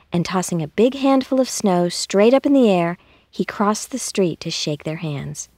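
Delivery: ordinary delivery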